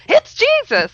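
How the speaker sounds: high, loud cry of recognition; inner scream. Retold or imitated. Her whole body cries out at the recognition, loud. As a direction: burst out with it. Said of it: "every cell in my body screamed".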